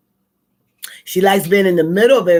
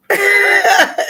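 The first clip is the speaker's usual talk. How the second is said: short high burst of laughter; amused laugh after warning; 5 dB louder than usual. Real, not acted.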